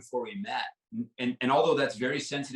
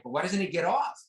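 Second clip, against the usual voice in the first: higher-pitched mock bafflement; retold baffled question, pitched up; baffled visitor; 6 semitones higher than usual. Visitor asking why the dog stays put, mock baffled.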